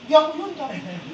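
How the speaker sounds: mock denial. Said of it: "Hindi yung".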